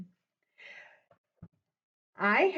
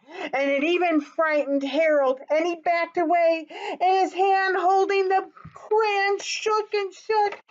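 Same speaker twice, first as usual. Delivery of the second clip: rising alarm; 8 semitones higher, 4 dB louder than usual. Acted. Dragon frightens Harold.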